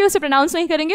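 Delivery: ordinary talk, nothing marked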